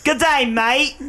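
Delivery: hammed-up Australian greeting; Australian accent. Shown as a demonstration. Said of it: "do my Australian?".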